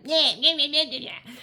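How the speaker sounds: high, loud bratty yammering; mock nagging. Upset person snapping at the one fawning, high loud mock nagging. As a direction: imitate someone griping in nonsense noises. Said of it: "Even when the person's, like, being like".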